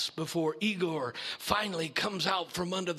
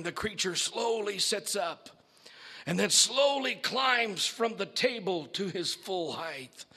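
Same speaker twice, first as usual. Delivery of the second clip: ordinary delivery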